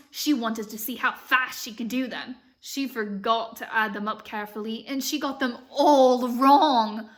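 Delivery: story reading with an imitated British lilt; American trying a British accent; amateur British accent. Shown as a demonstration. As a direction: read aloud in a patchy British accent. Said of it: "practicing reading this book in a British accent".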